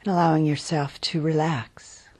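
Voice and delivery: unhurried, calm body-scan voice; guided meditation. Real, not acted. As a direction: guide them gently into settling, phrase by phrase. Leads a guided body-awareness meditation.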